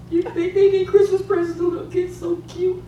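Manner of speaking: put-on very high, soft baby-talk coo; gushing cooing. Preacher voicing God admiring kids on the bus, playful cooing. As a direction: coo sweetly, high and small, to coax.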